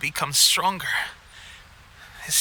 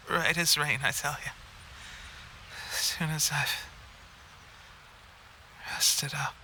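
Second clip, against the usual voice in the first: weak fading reassurance; 2 semitones lower, 8 dB quieter than usual. Acted. Sick man fading, reassuring.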